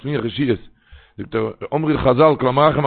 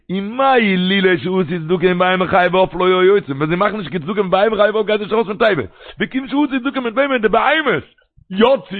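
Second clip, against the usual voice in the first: raised, rapid chanted reading of the midrash, pitched higher and louder; preaching; 7 semitones higher, 4 dB louder, much faster than usual. Acted. Preacher reading the midrash to the crowd, raised rapid chant. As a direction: fire through the text at a fast sing-song clip.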